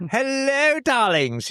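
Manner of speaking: lightly voiced old-time starlet greeting; a hint of a vintage purr; breathy vintage purr. [name] greets in posh put-on.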